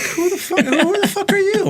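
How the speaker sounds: high-pitched incredulous outburst; hammed-up offence, half laughing; mock indignation. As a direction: throw it back in comic outrage. Retelling indignant reply to nosy stranger.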